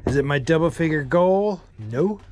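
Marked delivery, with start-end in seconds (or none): none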